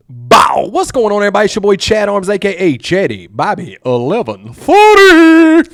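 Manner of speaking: loud, hyped show intro; podcast host intro. Acted. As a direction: open the show with energy.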